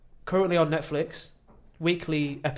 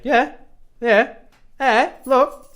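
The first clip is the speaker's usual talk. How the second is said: high, loud, triumphant yeahs; excited; 9 semitones higher, 9 dB louder, much slower than usual. Real, not acted. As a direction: gloat happily over good news. Proudly reporting four dating matches.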